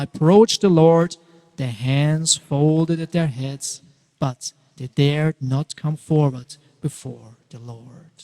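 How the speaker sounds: ordinary delivery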